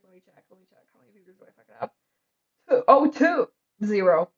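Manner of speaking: usual speaking voice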